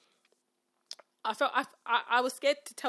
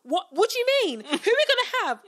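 high, loud, incredulous questioning; mock alarmed students; 10 semitones higher, 13 dB louder than usual. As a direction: fire back questions like a shocked class.

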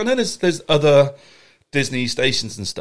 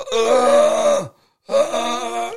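lightly voiced zombie groan; mock zombie grunting; 7 semitones higher, much slower than usual. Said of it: "That's quite a good zombie sound".

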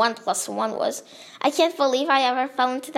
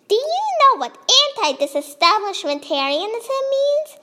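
put-on high-pitched cranky old lady voice; 10 semitones higher than usual. A narrator's reading. Little old lady quizzes the narrator, crankily. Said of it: "said in a cranky voice".

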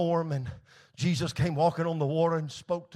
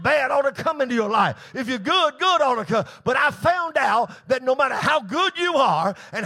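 stretched key words, rising sermon fervor; emphatic preaching; 5 semitones higher, 5 dB louder than usual. Acted. Preacher explaining suffering to the congregation, emphatic preaching. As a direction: preach each point with mounting insistence. Preaches on bad things happening.

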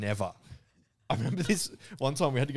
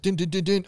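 chirpy higher little jingle; mock success jingle; 9 semitones higher, 8 dB louder than usual. Thinking he cracked the alarm, a mock success jingle. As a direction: sing out a mock computer chime. Imitating hacking success sound.

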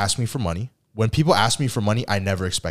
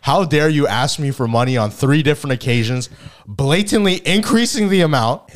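mock outrage; 6 semitones higher, 5 dB louder than usual.

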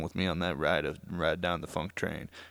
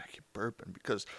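normal talking voice

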